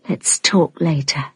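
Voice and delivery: soft unvoiced whisper. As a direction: breathe it as a secret invitation. Leah leading Rod to bed.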